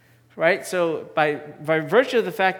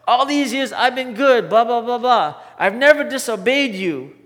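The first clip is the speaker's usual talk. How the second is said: put-on high, loud, indignant older son; resentful complaint; 6 semitones higher, 7 dB louder than usual. Older son protesting loudly to his father, indignant. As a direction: whine out years of grievance.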